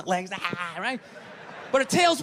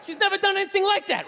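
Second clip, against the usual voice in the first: shouted high, panicked sorry; frantic apology; 12 semitones higher, 3 dB louder than usual. Retold or imitated. Owner apologizing to a stranger, shouting. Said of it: "I'm like".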